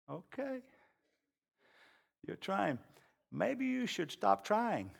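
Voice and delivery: calm them down quietly; gentle pastor. Retold or imitated.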